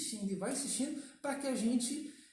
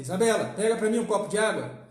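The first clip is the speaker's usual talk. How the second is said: loud, brisk command; harsh order; 10 dB louder, faster than usual. Shown as a demonstration. Father demanding water from his daughter, harsh loud order. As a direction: order it loudly and sharply. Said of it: "Olha a diferença".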